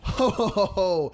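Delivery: booming Santa laugh; jolly ho ho ho. Pretend. Christmas intro Santa laugh.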